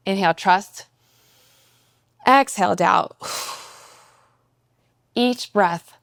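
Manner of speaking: measured meditation voice with long pauses; guided meditation. Acted. Coach guides a listener's walk and breath, guided meditation. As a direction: guide each step and breath, leaving space between phrases.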